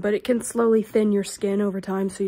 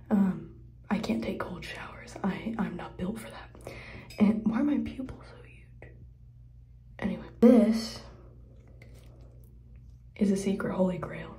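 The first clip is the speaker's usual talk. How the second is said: hushed bathroom whisper; 5 dB quieter than usual. Real, not acted. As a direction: whisper it close to the mic.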